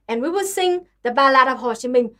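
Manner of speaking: ordinary talk, nothing marked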